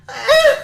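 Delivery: a sudden tiny squeak of protest; squeaky robot eh. Retold or imitated. Robot girl's reaction to the cable.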